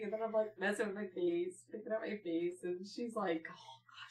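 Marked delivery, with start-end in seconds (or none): 3.5-4.1 whispered, impatient grumble; hushed retold aside. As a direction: whisper it like someone hiding. Hiding mom's hushed thought retold.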